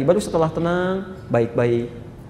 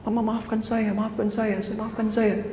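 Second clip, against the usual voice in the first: soft, higher, tearful begging; a child sobbing out an apology, softly; tearful plea; 5 semitones higher, 10 dB quieter, slower than usual. Retold or imitated. Preacher voices a weeping son apologising to his mother, soft and tearful. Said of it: "langsung bangun menangis".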